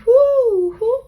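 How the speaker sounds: high, airborne "whoo"; playful whoosh. Pretend. Bristles going with the wind, playful whoosh. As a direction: whoop like something carried on the wind.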